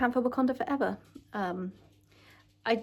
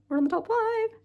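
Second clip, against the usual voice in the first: much higher, far-off sing-song voice; 13 semitones higher than usual. Shown as a demonstration. Excited vlogger teasing viewers about the top five, high sing-song. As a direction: announce it like a playful little chant.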